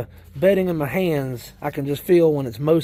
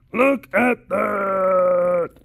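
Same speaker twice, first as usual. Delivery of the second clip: long drawn-out marveling shout; amazed; much slower than usual. Real, not acted. Showing viewers a handful of worms, amazed drawn-out call. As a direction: stretch it out in wonder.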